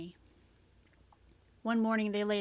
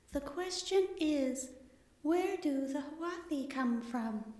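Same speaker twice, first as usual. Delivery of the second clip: higher, slow hollow librarian voice; 6 semitones higher, slower than usual. Acted. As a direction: read it out hollow and echoing. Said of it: "The librarian's hollow voice filled the hall".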